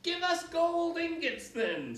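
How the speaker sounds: high, loud, slow cheeky shout; child shouting. A narrator's reading. A cheeky child shouting at the witch. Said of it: "shouted some wag".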